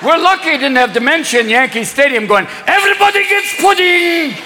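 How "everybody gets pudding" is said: high, sing-song proclamation; demented pope calling out. Acted. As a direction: chant it to a whole stadium.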